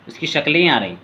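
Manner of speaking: usual speaking voice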